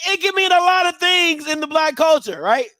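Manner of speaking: ordinary delivery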